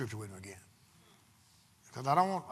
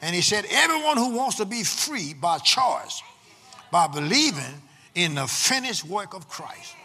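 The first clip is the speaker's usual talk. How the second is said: loud, emphatic preacher with long-held key words; full-force preaching; 3 semitones higher, 9 dB louder than usual. Real, not acted. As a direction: preach it to the congregation in bursts, punching the key words.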